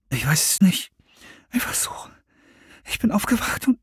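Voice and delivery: leises, stockendes Flüstern; geflüstert. Acted. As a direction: Angst leise andeuten. Verängstigtes Flüstern nachts. (Serenity flüstert verstört zur Freundin)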